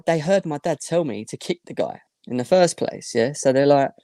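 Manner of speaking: ordinary talk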